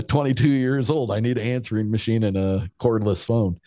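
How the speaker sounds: ordinary delivery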